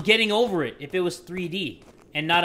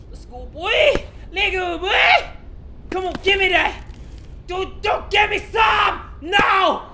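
high, loud, drawn-out effort shouts; hammy, effortful high-pitched urging; straining yells; 10 semitones higher, 4 dB louder, slower than usual. Real, not acted. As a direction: holler through the strain of a climb. Straining and yelling at the climb.

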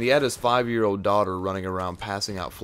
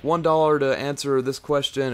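mock preacher offer; 6 semitones higher, 6 dB louder, slower than usual. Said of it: "He was like".

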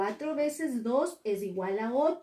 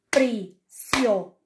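Prisionero in separate syllables, NE punched loud; stress on third syllable; 2 semitones lower, 6 dB louder than usual. Shown as a demonstration. Teacher showing the tonic syllable, Prisionero with a forceful NE. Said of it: "tenía mayor fuerza de voz".

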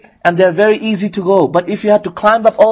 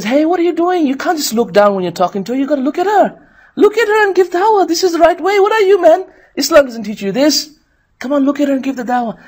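scolding shaitan; 9 semitones higher than usual. Retold or imitated. Shaitan scolding shy brother.

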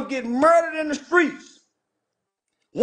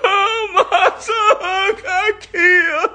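mock wailing grief, drawn out; exaggerated mock sobbing over a lost son; mock crying; 6 semitones higher, 5 dB louder, much slower than usual. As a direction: wail like a mourning parent, hammed up. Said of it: "You and Wallow were crying".

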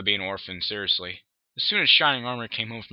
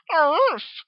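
high little grunt; baby dragon grunt; 6 dB louder than usual. Acted.

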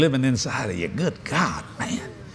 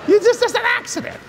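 very high, squeezed voice through laughter; mock reassurance. Preacher replaying his forgiveness of the breakage, high comic squeal.

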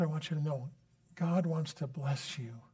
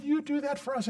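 put-on girls' voice; 11 semitones higher than usual. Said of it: "They said".

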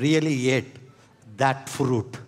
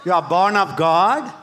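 preaching at full force; 2 semitones higher than usual. Real, not acted.

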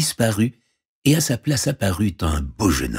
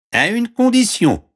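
very high frog voice; squeaky-high frog offering help; talking frog; 4 dB louder than usual. Acted. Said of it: "répondit la grenouille". (voix de grenouille très aiguë)

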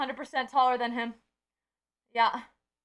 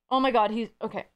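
ordinary delivery

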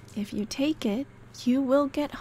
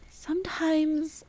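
whispered; 4 semitones higher, slower than usual. Acted. Wistful moment, talking to him.

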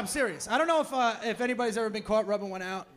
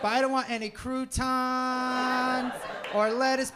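mock singsong ordering. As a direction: stretch the order out like an annoying, fussy customer. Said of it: "they sing when they order".

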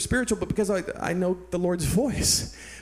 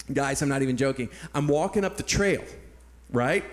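usual speaking voice

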